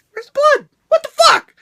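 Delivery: a mock panicked yelp mid-match; confused alarm. Wrestler wondering why he is not bleeding, high loud mock alarm. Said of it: "And I'm like".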